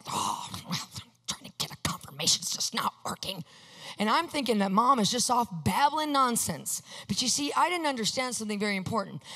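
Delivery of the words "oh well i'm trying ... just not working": low, whispered grumble; hushed mock self-pity; frustrated muttering. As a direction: grumble under the breath, acting out frustration. Muttering frustration to himself, retold.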